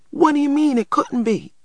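put-on high-pitched, panicky child's cry; panicked. A narrator's reading. Little boy challenges his brother's denial, in a panic. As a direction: demand an answer in panic. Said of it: "cried Christopher John in a panic".

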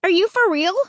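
high, incredulous question; can't believe it; disbelief. Acted. Narrator doubting Luke, incredulous.

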